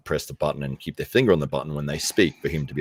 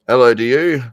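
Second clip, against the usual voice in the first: loud welcome; 7 semitones higher, 11 dB louder than usual. Real, not acted. Welcoming guest joining.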